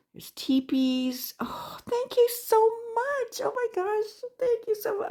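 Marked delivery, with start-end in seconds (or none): 1.4-5.1 high, squeaky grateful gush; overwhelmed, high-pitched gratitude; gushing thanks. Real, not acted. Thanking sender for gift.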